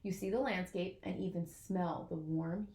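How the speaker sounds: plain reading voice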